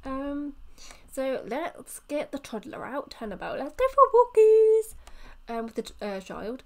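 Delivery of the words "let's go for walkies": high, loud cheer of walkies; excited call. Acted. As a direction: sing it out like calling a puppy. Player cheers toddler to walk.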